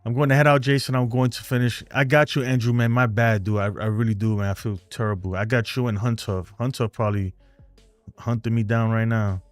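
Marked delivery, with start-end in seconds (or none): none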